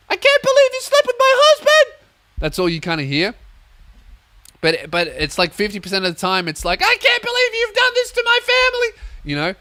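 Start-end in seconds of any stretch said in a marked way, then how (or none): 0.1-1.9 shrieked, very high and loud; hammed-up betrayed wife screech; screaming TV drama. Acting out overheard TV drama. 6.8-8.9 high screeching reality-TV housewife; Real Housewives drama. Acted. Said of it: "Like that Real Housewives drama shit".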